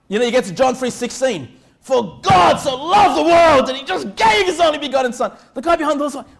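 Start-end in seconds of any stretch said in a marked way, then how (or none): 1.8-5.3 yelled scripture, high and loud; overzealous doorstep shout; mock shouting preacher. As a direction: bellow the verse at the poor neighbor.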